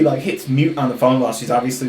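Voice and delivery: usual speaking voice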